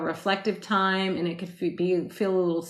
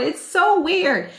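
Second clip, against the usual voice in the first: 1 laughing; 10 semitones higher, 6 dB louder, slower than usual. Real, not acted. Laughs at own reaction.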